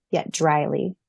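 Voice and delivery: ordinary delivery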